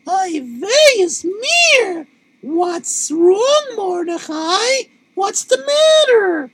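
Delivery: high, slow put-on Yiddish wife voice. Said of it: "And his wife said".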